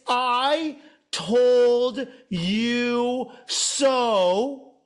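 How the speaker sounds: hugely drawn-out, sing-song gloat; gloating I told you so. Acted.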